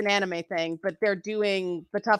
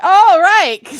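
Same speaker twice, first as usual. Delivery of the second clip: loud, high-pitched "oh" of realisation; sudden realisation; 11 semitones higher, 15 dB louder than usual. Real, not acted. Remembering a private joke, loud sudden realisation. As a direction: burst out as the penny drops.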